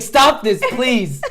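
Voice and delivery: high, loud retold plea; mock exasperation. Reacting to the memes, mock exasperated plea. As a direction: beg loudly, half laughing, hammed up.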